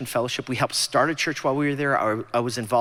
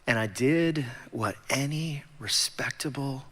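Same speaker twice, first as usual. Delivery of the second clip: normal talking voice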